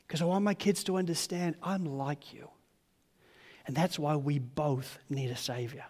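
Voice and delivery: normal talking voice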